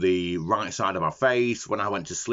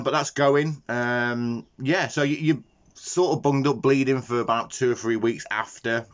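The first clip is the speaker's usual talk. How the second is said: normal talking voice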